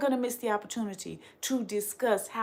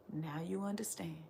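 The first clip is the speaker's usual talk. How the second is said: whispered, much softer; 4 semitones lower, 14 dB quieter than usual. Real, not acted. Answering her mocking critics in a sudden whisper. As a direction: drop to a breathy whisper. Refrain whispered after laugh.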